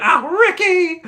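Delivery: long, high, loud cry of the name; mock movie scream. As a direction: stretch the name into an anguished yell.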